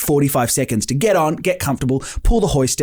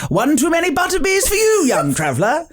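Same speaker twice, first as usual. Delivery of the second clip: lightly voiced cheeky elf, higher; elf staffer in character; 14 semitones higher than usual. Elf staffer teasing the big rider, high playful voice. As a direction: tease the guest in a playful fantasy voice. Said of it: "And the elf goes".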